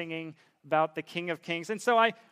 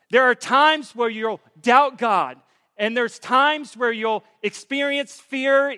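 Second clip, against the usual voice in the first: loud, impassioned preaching, voice raised; full-force preaching; 5 semitones higher, 9 dB louder than usual. Real, not acted. Pastor urging his congregation, preaching at full force. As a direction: drive it home to the congregation at full voice.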